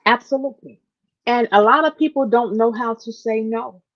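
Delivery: ordinary delivery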